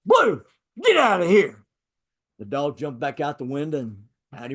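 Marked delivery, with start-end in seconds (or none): 0.1-1.6 shouting at a dog. Retold or imitated. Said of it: "gets up and goes".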